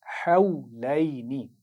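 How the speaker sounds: drawn-out, melodic tajweed recitation; slow Quran recitation. Shown as a demonstration. Teacher reciting slowly for pupils to repeat. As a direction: chant each syllable slowly for the class to copy. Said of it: "ये जो लफ्ज़ हमने अभी पढ़ा है".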